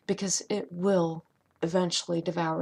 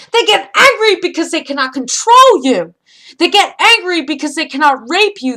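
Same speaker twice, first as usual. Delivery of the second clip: much higher and much louder, shouting the sermon; full-force preaching; 10 semitones higher, 17 dB louder than usual. Acted. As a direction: roar it out like a fiery preacher.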